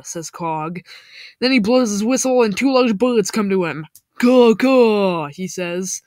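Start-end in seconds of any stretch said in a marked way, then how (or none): 4.2-5.3 loud, drawn-out caw; imitated bird caw. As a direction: call out like a big bird. Imitating a call to birds.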